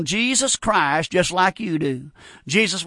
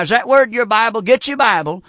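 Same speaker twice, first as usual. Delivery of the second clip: ordinary talk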